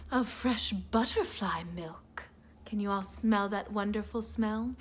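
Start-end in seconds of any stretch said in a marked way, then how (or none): 0.1-2.2 soft, low secret whisper. A narrator's reading. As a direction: breathe it like a secret. Whispered story detail.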